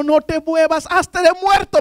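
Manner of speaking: advertencia urgente en tono agudo; grito imitado. Retold or imitated. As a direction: imita un grito de aviso, con voz muy alta de tono. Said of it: "él iba gritando". (grito de advertencia imitado)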